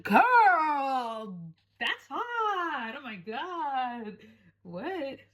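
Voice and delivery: swooning disbelief. Retold or imitated. Reacting to the Indian girl's accent on Instagram, high drawn-out swoon. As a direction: gush in stunned awe, stretching each word. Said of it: "I was just like".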